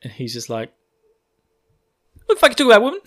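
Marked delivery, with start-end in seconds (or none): 2.2-3.0 high, loud mock bafflement; hammed-up annoyed retort; exasperated outburst. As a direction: snap back in comic disbelief. Rand exasperated at Celine, acted.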